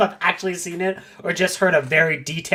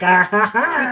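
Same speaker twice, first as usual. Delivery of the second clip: creepy laugh; 2 semitones higher, slower than usual. Pretend. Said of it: "she'd be like".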